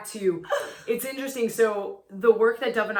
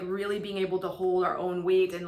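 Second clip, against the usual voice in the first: usual speaking voice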